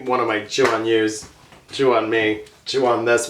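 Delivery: ordinary talk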